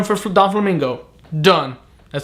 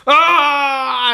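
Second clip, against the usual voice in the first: mock angry yell; 9 semitones higher than usual. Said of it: "while he's like".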